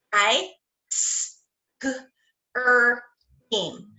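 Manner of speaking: each sound of ice cream said apart; sounded out letter by letter. Shown as a demonstration. Teacher sounding out a word for kindergarteners, one sound at a time. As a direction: segment the word into separate sounds for the kids. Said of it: "do it with our touch".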